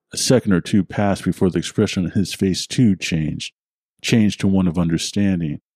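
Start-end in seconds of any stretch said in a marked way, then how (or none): none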